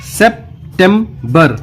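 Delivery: "September" very slow: sep... tem... ber; broken into syllables. Shown as a demonstration. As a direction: chop "September" into three English syllables with pauses. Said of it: "आप spelling ऐसे भी याद कर सकते".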